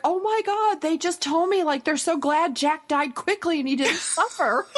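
shocked friend, pitch way up; Janet outraged. Retold or imitated. Grieving mother retelling a tactless comment, high and appalled. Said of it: "she would go like".

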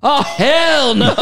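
mock outraged mother. Podcast host teases mother about maternal instincts, mock outrage. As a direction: stretch it out, laughing as you mimic her. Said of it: "The maternal instincts of".

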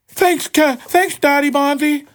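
put-on high, slow childlike thank-you; little kid voice. Podcaster playing his own son thanking Daddy Bonzi, in a high kid voice. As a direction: answer like a small boy thanking his dad. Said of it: "that was weird".

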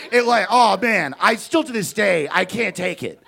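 ordinary delivery

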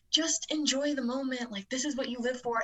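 lightly voiced upbeat coach; coach impression. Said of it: "the Coach Tyler impression".

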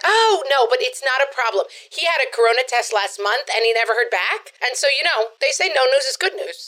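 lightly voiced chatty neighborhood mom; breezy mom who is proud of the nephew; gossipy suburban mom. Sketch mom brushing off worry.

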